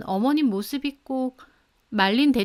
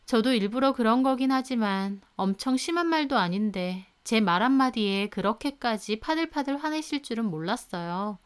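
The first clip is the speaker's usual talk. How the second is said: ordinary delivery, nothing marked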